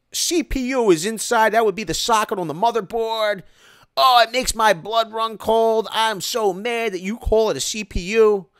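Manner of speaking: a whiny, offended tech pedant; mock angry commenter. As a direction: ham up the outraged know-it-all commenter. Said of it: "I got all kinds of hate".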